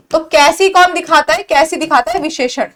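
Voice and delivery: usual speaking voice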